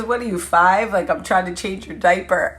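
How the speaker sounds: loud retold ribbing; teasing husband. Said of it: "He goes".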